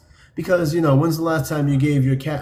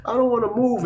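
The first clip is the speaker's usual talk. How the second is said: higher mock cooing cat owner; 8 semitones higher than usual. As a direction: coo over the sleeping cat, gently mocking. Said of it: "it's like".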